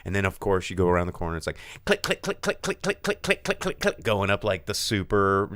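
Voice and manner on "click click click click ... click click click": high, evenly ticking clicks; imitated chain-lift clicking. Coaster going round the corner and up, ticking imitation. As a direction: mimic a coaster's mechanical ratchet.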